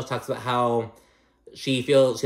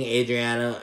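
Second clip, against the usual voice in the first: ordinary talk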